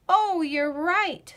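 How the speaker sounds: dramatic little realisation; emphatic realisation. Acted. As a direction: announce it as a big discovery. Lola suddenly worried for friend.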